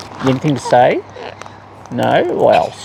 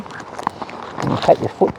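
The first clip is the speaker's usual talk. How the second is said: slow whisper, much softer; whispered coaxing; 6 dB quieter, slower than usual. Real, not acted. Owner coaxing her bird to step up in a slow whisper.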